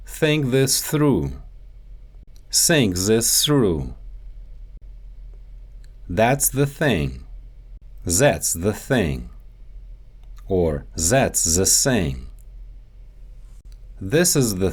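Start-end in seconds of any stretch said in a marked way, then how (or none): 2.5-3.9 Russian accent, "Think this through" said as "sink zis sru"; Russian accent, S and Z for each TH, slow. Shown as a demonstration. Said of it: "The T H sound". 8.0-9.3 Russian accent, "That's" said as "zats"; Russian accent, Z in place of the TH in "That's". Shown as a demonstration. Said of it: "The T H sound". 11.0-12.3 Russian accent, "that's the thing" said as "zats ze sing"; Russian accent, Z and S for every TH. Shown as a demonstration. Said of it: "The T H sound".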